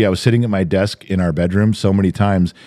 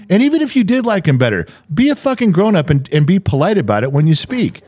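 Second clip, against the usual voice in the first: higher, heated rant; exasperated rant; 7 semitones higher than usual. Real, not acted. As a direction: scold them hard, fed up. Ranting at boastful people.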